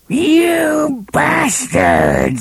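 high, drawn-out screech of outrage; screaming woman. A narrator's reading. Old woman curses Roland from inside the hut, screaming. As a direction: shriek it high, stretching each word. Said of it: "screamed a woman's voice from the shadows".

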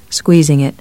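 ordinary delivery, nothing marked

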